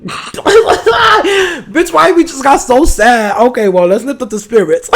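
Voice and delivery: high, loud laughing outburst; laughing shout. Real, not acted. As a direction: snap out of the sadness with a laughing yell. Laughing shout breaking sad mood.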